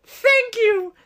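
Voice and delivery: squeaky high thanks; high thank you. Retold or imitated. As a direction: chirp it sweetly. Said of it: "Kat goes".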